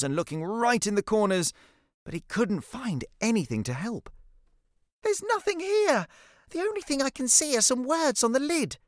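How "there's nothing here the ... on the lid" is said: put-on very high-pitched child's call; boy calling over. A narrator's reading. Jackson reporting to Cece from the box, calling over. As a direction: call across in a high boy's voice. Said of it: "he called over to Cece".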